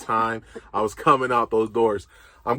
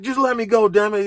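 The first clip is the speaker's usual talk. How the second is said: mock angry snap; 8 semitones higher than usual. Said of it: "I'm just getting crazy with them".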